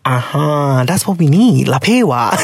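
usual speaking voice

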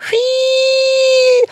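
very high, sustained kettle whistle; whistling kettle. Retold or imitated. Host describing making tea, imitating the whistling kettle. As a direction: imitate a kettle shrieking on one held note. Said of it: "Whistling kettle no".

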